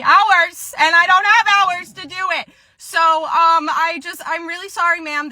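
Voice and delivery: high, strained protest easing into apology; flustered employee. Acted. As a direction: plead in a high customer-service voice. Flustered employee refuses, then apologizes.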